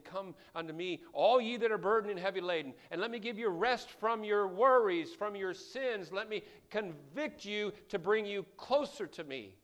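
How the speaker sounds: loud, high pleading call; preacher voicing God's loud call to the weary; preached invitation. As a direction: call them home with full voice.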